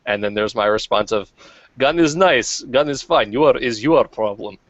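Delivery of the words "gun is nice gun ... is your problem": mock Russian accent. His own mock Russian reply.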